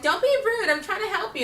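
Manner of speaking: high mock scolding; high prissy mock stylist. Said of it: "they look at me like".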